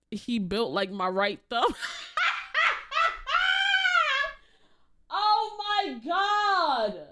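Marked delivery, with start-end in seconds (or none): 1.8-7.0 high, slow shriek of laughter. Real, not acted. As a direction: scream it out laughing. Laughing hard at clip.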